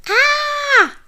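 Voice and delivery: loud, very high scream; blood-curdling fright. A narrator's reading. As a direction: sheer terror shriek. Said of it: "Hugly screamed".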